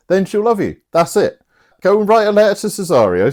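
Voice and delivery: high, pushy mate talking someone into it; voicing Fabian. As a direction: egg him on like a cocky schemer. Fabian voiced scheming, laddish.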